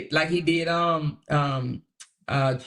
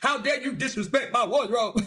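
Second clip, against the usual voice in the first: higher, indignant mock voice; mock Kevin defending his wardrobe, higher and indignant; mocking offended Kevin; 6 semitones higher than usual. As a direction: play him outraged about his clothes. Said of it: "that pissed Kevin off too".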